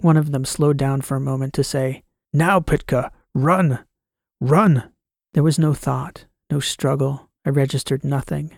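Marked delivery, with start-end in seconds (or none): 2.3-4.9 robotic urgent shout, rising. Acted. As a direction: urge them to run, louder each time. Robot urging escape in battle.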